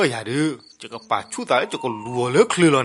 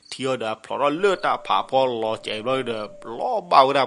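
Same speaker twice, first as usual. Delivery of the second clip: usual speaking voice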